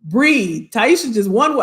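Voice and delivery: usual speaking voice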